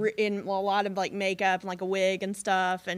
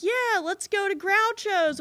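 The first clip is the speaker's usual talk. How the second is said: excited; 11 semitones higher, 3 dB louder than usual. Retold or imitated. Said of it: "we were like".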